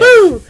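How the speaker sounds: high, loud "woo"; excited whoop. Real, not acted. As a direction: let out a sudden high whoop. Cheering into the next category.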